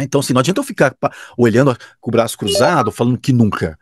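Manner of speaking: normal talking voice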